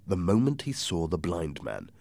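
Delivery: ordinary delivery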